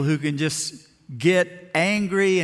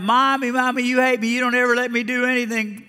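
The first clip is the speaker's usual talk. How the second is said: a boy's mock tantrum wail, pitched up high; imitating a screaming kid; 7 semitones higher than usual. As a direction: play a spoiled child yelling at his mom. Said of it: "screamed out".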